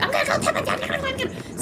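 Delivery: squeaky, garbled mock brat; tattling little girl. Little girl threatens to tell dad, bratty whine. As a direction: imitate a whiny kid, high-pitched.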